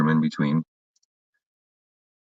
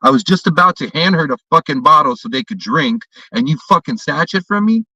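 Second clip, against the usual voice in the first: raised angry tirade; retold tirade; 5 semitones higher than usual. Yelling at the drunk father, raised angry tirade. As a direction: tear into him, higher and sharper. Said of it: "I said".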